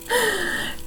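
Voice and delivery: soft storyteller's gasp; mock gasp. Teacher reacting to the kitten's mess, mock gasp. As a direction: gasp softly for small listeners.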